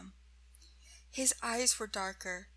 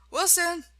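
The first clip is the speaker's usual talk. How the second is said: high, loud call to a stagehand; 8 semitones higher, 8 dB louder than usual. A narrator's reading. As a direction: summon him sharply by name. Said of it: "calling a stagehand".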